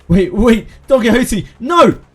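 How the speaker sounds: frantic high yell, rising to a cry; desperate shout. Acted. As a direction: shout to stop her, panicked. Desperate cry as ally taken.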